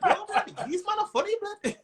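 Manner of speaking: high, laughing Birmingham accent impression. Comic mimicking a Birmingham crowd member in a laughing Birmingham accent. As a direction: ham up a Brummie fan through laughter. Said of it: "I hear the accent".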